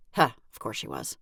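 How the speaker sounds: ordinary delivery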